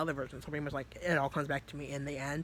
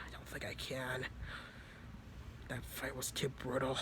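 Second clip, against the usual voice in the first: weak dying voice; 7 dB quieter than usual. Retold or imitated. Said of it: "just talking like that".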